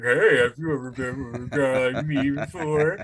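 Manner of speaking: a put-on creep's come-on; lightly voiced sleazy suitor; creepy messager. Guy messaging hot girls, creepy come-on. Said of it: "be like".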